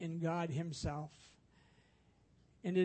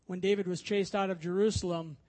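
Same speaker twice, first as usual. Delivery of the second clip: ordinary talk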